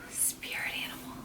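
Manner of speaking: voiceless whisper, very soft; hushed, breathy whisper to oneself. Real, not acted. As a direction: whisper it under your breath. Repeats the question in a whisper.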